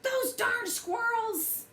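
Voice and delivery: put-on high, slow cranky old man shouting; cranky old codger yelling at squirrels; grumpy old man yell. A narrator's reading. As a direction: shake your fist and holler like a grouchy old man. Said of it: "he shook his old man fist and yelled".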